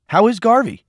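put-on boy hungry for news of his friend; excited question. A narrator's reading. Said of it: "Cole asked excitedly".

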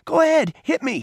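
very high, angry dare; heated, provoking cry; taunting. A narrator's reading. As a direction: bait him with a high-pitched challenge. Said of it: "he taunted".

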